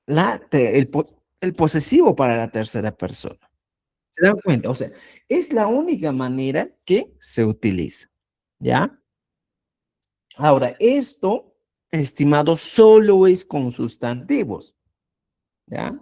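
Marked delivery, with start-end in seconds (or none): none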